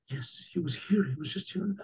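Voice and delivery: hushed, half-whispered shock; hushed realization. Retold or imitated. Innkeeper realizing who his guest was, hushed shock. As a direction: let the voice drop away in dawning realization.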